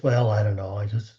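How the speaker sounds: low, hesitant mock brush-off; awkward compliment deflection. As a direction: act bashful, dropping low and trailing away. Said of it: "hard time accepting a compliment".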